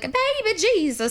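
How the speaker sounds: put-on Baby Jesus sung out high and sweet; cat name accent. High, sing-song pet-name voice. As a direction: owner uses the cat's special voice to say Baby Jesus, high and cutesy. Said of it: "every cat has their own accent that I do".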